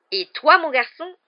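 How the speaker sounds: loud, high, threatening question; sifflant, menaçant. A narrator's reading. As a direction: turn on the boy with tense menace. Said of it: "siffla-t-il à l'adresse de Harry". (menace tendue, voix haute)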